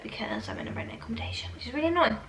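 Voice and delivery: hushed whispered aside; whispering. Real, not acted. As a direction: drop to a whisper. Rented flat aside, muttered.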